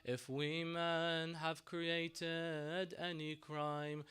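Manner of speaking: slow grieving plea. Retold or imitated. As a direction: drag each word out in sorrow. Imam Hussein pleading for water.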